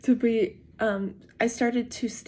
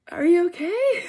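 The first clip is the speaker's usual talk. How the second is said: high, louder rising question; retold worried call; 9 semitones higher, 6 dB louder than usual. Wife checking on her husband during the quake, calling out. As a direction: call it out high, imitating the moment.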